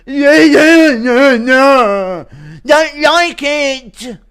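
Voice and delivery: high, loud, slow stubborn grumble; Menifield impression. Man refusing police orders, slow and loud. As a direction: drag it out, refusing to budge. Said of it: "Impression of Alonso Menifield".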